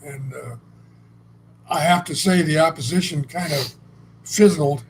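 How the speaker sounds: normal talking voice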